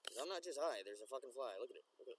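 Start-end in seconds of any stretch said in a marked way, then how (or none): none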